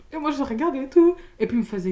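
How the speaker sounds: usual speaking voice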